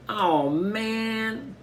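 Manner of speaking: high, pained Byron; a boy caught peeking, sulking high; dismayed groan. A narrator's reading. Byron, just popped on the head, groaning high.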